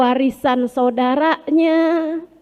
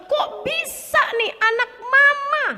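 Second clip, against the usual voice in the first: hammed-up incredulous scolding, pitched high; mock angry mother; 8 semitones higher, 3 dB quieter than usual. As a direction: imitate a mother scandalised by a report card. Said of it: "marah banget".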